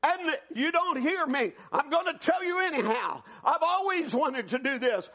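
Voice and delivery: high-pitched, urgent cry to God. Retold or imitated. Man at the altar calls out to God, crying out. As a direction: cry out to the Lord in desperation. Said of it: "He begins to cry out".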